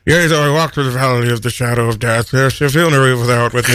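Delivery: reciting scripture solemnly; solemn psalm recital. Pretend. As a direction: intone like a preacher. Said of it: "That's Psalms".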